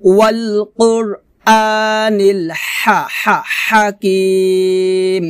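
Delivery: slow tajweed chant with drawn-out vowels; Quran recitation. Shown as a demonstration. Teacher modelling the verse for learners, slow measured recitation. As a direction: recite slowly, holding the final syllable long.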